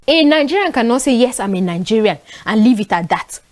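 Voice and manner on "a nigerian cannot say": ordinary talk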